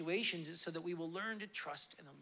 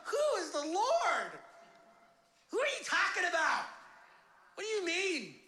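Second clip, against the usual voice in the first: high, raised, incredulous challenge; indignant Pharaoh; 11 semitones higher, 5 dB louder than usual. Acted. Pharaoh challenges Moses about the Lord, indignant. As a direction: throw the demand back with scorn.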